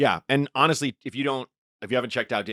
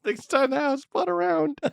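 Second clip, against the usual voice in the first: put-on high silly drunk voice. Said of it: "Lenny Bruce's".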